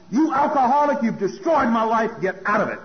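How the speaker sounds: loud, high-pitched accusing shout; angry yell. Retold or imitated. Son throwing out the alcoholic parent, angry yelling. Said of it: "He yelled at me as loud".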